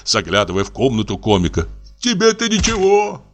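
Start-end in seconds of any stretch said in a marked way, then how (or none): 2.0-3.2 high, tearful lament; frightened whining Pochechuev. A narrator's reading. Pochechuev wails to the barber, high and tearful. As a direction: wail about the beating in your flat.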